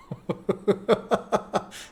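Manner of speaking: high run of chuckles; laugh. Real, not acted. Host cracking up at the blocked phrase, laughing.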